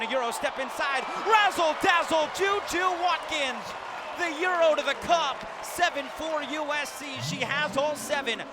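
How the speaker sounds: shouted highlight call, easing down toward the score; thrilled basket call. Real, not acted. Announcer celebrates Juju Watkins' Euro step, thrilled. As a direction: burst loud and high on the finish.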